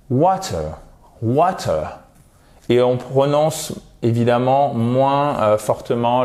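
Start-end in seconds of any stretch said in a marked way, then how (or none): 0.1-2.0 British "water" with a distinct T; British English. Shown as a demonstration. Teacher contrasting with American, British accent on "water". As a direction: say "water" British-style, crisp T, no final R. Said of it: "en British English, ça sera plus avec la prononciation d'un T".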